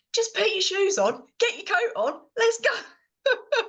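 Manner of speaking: high, strained mock yell at the boys; mother losing patience on the school run; exasperated mother. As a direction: hurry the kids out the door, fed up.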